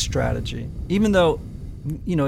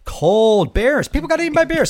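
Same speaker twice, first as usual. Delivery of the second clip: mock dramatic outcry; 8 semitones higher, 5 dB louder than usual. Mock-dramatic tragedy joke.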